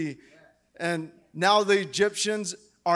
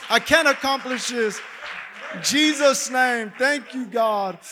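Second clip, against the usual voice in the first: high loud declaring shout; triumphant shout; 6 semitones higher, 6 dB louder than usual. Acted. Preacher rallies the church in praise, high triumphant shout. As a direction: shout the victory out.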